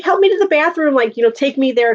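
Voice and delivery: high, pleading mock fright imitating friends; mock scared plea. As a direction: beg to be walked to the bathroom. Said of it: "they were like".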